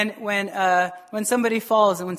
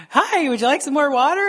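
higher, put-on sweet offer; fake cheerful host; 5 semitones higher, 4 dB louder than usual. Grumbling host offering guests more water, falsely cheerful. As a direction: play the falsely gracious host.

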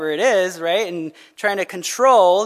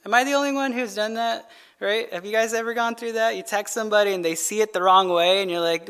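ordinary talk, nothing marked

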